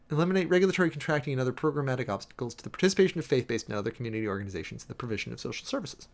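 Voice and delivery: ordinary delivery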